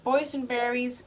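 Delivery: plain reading voice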